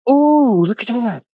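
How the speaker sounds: very high, gasping ooh, look at that; squeaky high mock amazement; wide-eyed wonder. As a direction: play a dazzled tourist gawking at everything. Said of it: "I'd be like".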